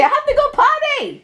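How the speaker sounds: put-on squeaky little kid voice. Mother voices child's line.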